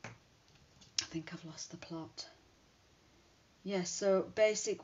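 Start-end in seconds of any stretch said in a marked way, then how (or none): 0.0-2.3 slow amused whisper at herself; whispered self-mockery. Real, not acted. Laughing at her own muddle, a slow whisper. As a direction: breathe it out as a rueful aside. Self-mocking aside after mistake.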